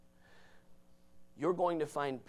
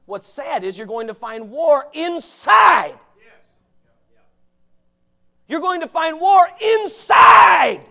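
slow, booming shout, much louder and higher; preaching shout; 13 semitones higher, 20 dB louder, slower than usual. Real, not acted. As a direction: hammer it home at full volume.

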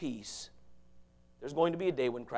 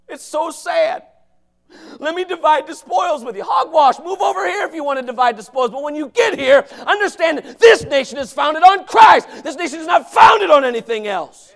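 loud, high, hollering sermon; fiery preaching; 13 semitones higher, 19 dB louder than usual. Real, not acted. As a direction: thunder it at the congregation. Preaching at full force.

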